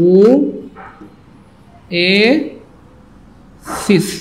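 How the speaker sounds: ও, এ, সিস as three drawn-out parts with long gaps; syllable-by-syllable pronunciation. Shown as a demonstration. Teacher showing how oasis sounds, ও, এ, সিস each held separately. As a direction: break it up slowly: "oh", "ay", "sis". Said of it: "উচ্চারণটা হবে এমন".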